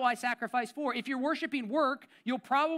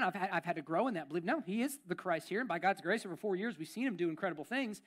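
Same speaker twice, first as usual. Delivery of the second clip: ordinary talk, nothing marked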